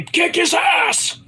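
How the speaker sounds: high, drawn-out command; slow, strained bellow, pitch way up; mock yelled order. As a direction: imitate a furious boss ordering an attack. Acting out boss ordering an attack.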